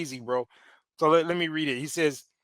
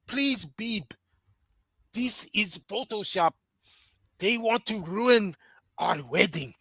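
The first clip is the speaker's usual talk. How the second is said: slow, pleading mock African accent, pitched a little up; hammy African accent, begging; exaggerated African accent, pleading; bad African accent; 3 semitones higher, slower than usual. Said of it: "our bad African accent for dramatic effect".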